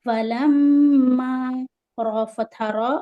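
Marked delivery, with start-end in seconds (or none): 0.1-1.3 فَلَمَّا with a long nasal hum on the doubled m; slow chanted فَلَمَّا, humming through the m; ghunna. Shown as a demonstration. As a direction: hold the m of فَلَمَّا for two beats. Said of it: "میم پر غنہ کریں گے دو حرکت".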